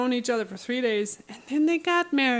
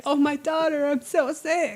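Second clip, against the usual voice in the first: whiny, higher mock-sick father; feeble sick dad; 5 semitones higher than usual. Father whining to his daughter to pity him. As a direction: lightly play the pitiful starving dad.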